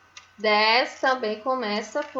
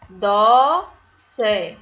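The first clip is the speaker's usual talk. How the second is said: "Doce" as a loud, held "DÓÓ", then "ce"; syllable by syllable; 2 semitones lower, 8 dB louder than usual. Shown as a demonstration. Teacher reading the spelling to pupils, "Doce" broken into long syllables. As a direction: separate the two beats of "Doce". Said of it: "Vamos ver a escrita".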